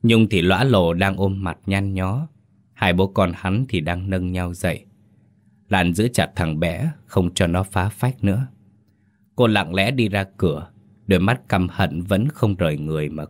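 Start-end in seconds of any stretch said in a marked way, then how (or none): none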